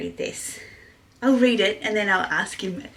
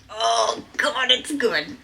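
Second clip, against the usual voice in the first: usual speaking voice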